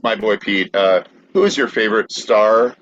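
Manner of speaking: normal talking voice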